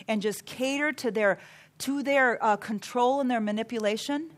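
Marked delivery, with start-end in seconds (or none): none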